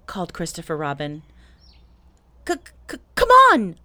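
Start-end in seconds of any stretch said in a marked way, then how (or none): 2.5-3.8 very high, loud squeaky shout; stutter, then burst out with the call; stammered call. A narrator's reading. Pooh and Piglet pass the shout along, stammering and squeaky. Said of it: "called Pooh and Piglet".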